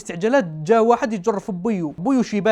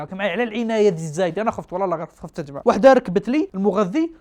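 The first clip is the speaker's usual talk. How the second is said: normal talking voice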